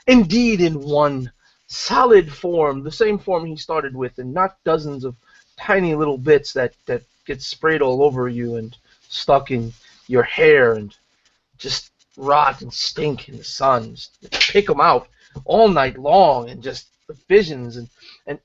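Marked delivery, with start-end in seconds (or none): none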